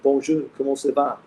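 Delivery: a quick French greeting tossed off on request; showing he can talk French, breezy. Pretend. Answering a viewer who asks if he speaks French, light mock French lilt. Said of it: "Can you talk French?".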